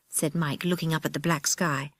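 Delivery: usual speaking voice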